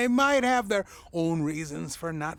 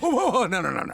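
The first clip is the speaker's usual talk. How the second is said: eager interruption; 3 semitones higher, 4 dB louder, much faster than usual. Acted. Stopping her eagerly.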